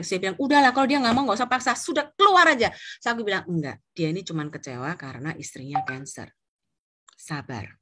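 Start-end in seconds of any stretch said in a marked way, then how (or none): 0.3-2.7 higher-pitched, impatient urging; fed-up husband. Retold or imitated. Husband telling his wife to stop and go, fed up. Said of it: "suami saya nggak tahan". 3.5-7.8 low, soft reassurance; calming. Retold or imitated. Wife explaining the client's grief to her husband, calming.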